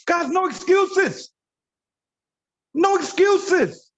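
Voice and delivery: high-pitched, drawn-out call to the team; emphatic exhortation. Real, not acted. Trainer telling the team to show up, emphatic and insistent. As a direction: stretch it out high, driving the point home.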